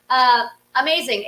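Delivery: ordinary talk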